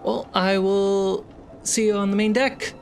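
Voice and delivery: ordinary talk, nothing marked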